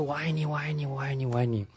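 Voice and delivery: soft, distant echo repeating; fading echo. Retold or imitated. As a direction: let it fade away like an echo. (远处渐弱的回音)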